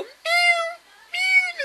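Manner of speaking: tiny, very high-pitched mews; kitten meows. Retold or imitated. Storyteller imitating tiny kittens for viewers, high squeaky meows. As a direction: mimic a little kitten mewing. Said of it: "they'll just sit and go".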